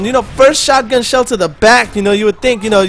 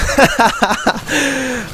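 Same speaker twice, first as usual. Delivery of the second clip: laughing; 3 semitones higher, 4 dB quieter than usual. Real, not acted. Laughs at own impression.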